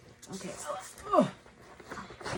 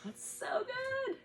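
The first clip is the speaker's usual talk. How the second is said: high praise; slower than usual. Real, not acted. Mom praising her daughter's lion breath, high and slow. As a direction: stretch it out high and delighted. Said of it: "praising child's lion breath".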